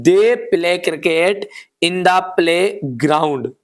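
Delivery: very slow, word by word for copying; dictating at a crawl; slow dictation. Shown as a demonstration. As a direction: read it out so students can write it down. Teacher dictating sentence slowly.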